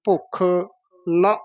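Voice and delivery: ਭੁੱਖ, a gap, then short ਨ; small break. Shown as a demonstration. Teacher modelling the break, words kept apart. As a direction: slowly separate ਭੁੱਖ from ਨ. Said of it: "ਇੱਥੇ ਯਮਕ ਲਾ ਦੇਣੀ ਹੈ".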